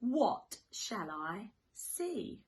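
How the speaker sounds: usual speaking voice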